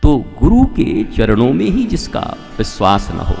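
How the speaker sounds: ordinary talk, nothing marked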